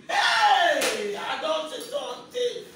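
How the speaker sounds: high, loud wail of disbelief; shocked outcry. Real, not acted. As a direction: cry it out slowly, in shock. Mother reacts in shock to confession.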